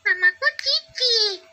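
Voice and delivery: put-on high, slow small girl's voice; little girl Cici. Narrator voices child Cici. (suara anak perempuan kecil)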